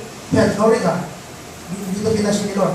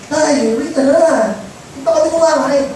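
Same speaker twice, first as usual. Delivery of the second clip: put-on child calling father; 3 semitones higher than usual. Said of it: "Sasabihin ng tatay mo".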